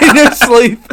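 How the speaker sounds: loud, high laughing jab; laughing tease. Real, not acted. Teasing friend, laughing.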